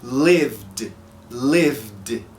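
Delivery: "lived" ending straight in vd, no vowel; correct lived. Shown as a demonstration. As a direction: glide from v to d in "lived". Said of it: "eu pronuncio".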